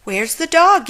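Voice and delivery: high, loud searching yell. Acted. Witch yelling during the chase, high and loud. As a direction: cry out hunting for the dog. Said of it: "yelled another".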